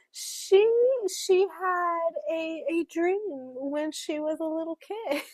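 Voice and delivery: high, slowed mock-earnest delivery; sarcastic. Reader jokes about the heroine's childhood dream, sarcastic. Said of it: "I'm being sarcastic right now".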